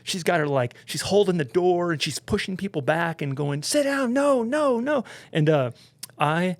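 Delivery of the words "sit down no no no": high, urgent mock command; frantic ordering. Stewardess pushing people back from the door, frantic orders. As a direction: imitate a stewardess holding back a crowd.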